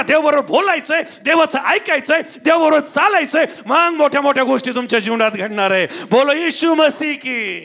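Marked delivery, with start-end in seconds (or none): 6.0-7.6 slow, drawn-out call to the congregation; stretched-out preacher's chant call; rallying call. Real, not acted. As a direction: call it out for the crowd to answer.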